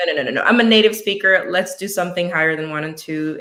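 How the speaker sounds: retelling her own adamant refusal out loud; emphatic protest. Retold or imitated. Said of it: "And I'm like".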